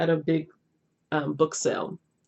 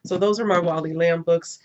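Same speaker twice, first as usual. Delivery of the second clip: ordinary delivery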